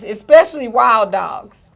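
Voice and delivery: ordinary talk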